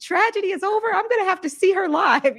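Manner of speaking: high, loud thrilled quote of her own fan reaction; excited gush. Retold or imitated. Host recalling her reaction to the singer, high excited squeal. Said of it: "I was like".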